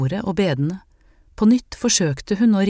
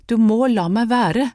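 crying out; 5 semitones higher, 4 dB louder than usual. A narrator's reading. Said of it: "gråt hun".

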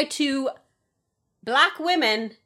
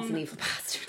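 whispered curse; 4 semitones lower, 10 dB quieter than usual. Real, not acted. Cursing Trump quietly.

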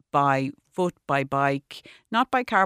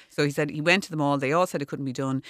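normal talking voice

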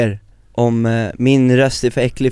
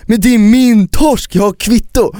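high, louder, slow insisting; indignant Albin; 8 semitones higher, 5 dB louder than usual. Retold or imitated. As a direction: wave the receipt, outraged. Albin chasing waiter. (upprörd, hög insisterande röst)

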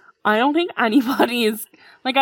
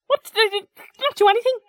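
mock angry dad; 14 semitones higher, 3 dB louder, faster than usual. Angry father quoted.

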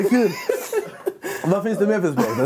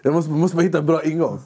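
ordinary talk, nothing marked

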